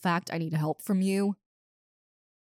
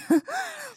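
high giggle. Acted. Teasing joy.

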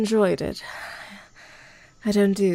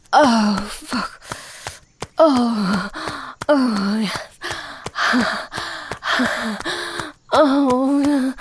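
breathless moans building up, voice cracking; moaning; 6 semitones higher, 4 dB louder, slower than usual. Acted. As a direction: let the moans climb, gasping between words.

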